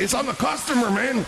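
high voice, laughing through the excuse; mock blaming cook. Cook blaming the customer, high and laughing. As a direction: pass the blame in a squeaky, giggly voice.

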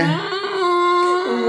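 long high hum, then a puzzled question; mock puzzlement. Acts out reaction to blackface comments.

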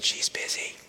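put-on a child's small hushed whisper; soft whispered hello on the phone. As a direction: breathe it out like a hiding four-year-old. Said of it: "And Jimmy just said".

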